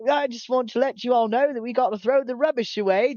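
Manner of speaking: high-pitched British manager announcement; excited British accent. Retold or imitated. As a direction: imitate a chipper British announcer. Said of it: "story with the British guy".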